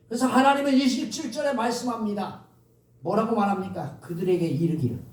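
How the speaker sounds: usual speaking voice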